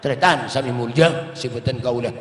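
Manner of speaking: ordinary delivery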